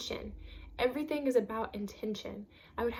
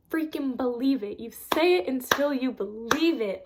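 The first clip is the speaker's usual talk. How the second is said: much louder, insistent repeat; emphatic; 3 semitones higher, 9 dB louder than usual. Real, not acted. Urging viewers to believe it, loud and insistent. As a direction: drive the point home hard. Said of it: "I cannot stress that enough".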